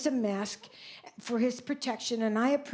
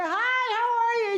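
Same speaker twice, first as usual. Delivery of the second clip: gushing greeting; 10 semitones higher, 3 dB louder than usual. Retold or imitated. Imitating the overly happy acquaintance.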